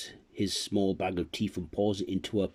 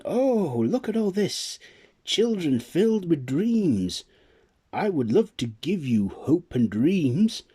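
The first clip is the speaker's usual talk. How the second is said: much higher, louder, marvelling; delighted Sandman; 10 semitones higher, 6 dB louder than usual. Acted. The Sandman seeing the children full of dreams, delighted. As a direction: gush with wonder.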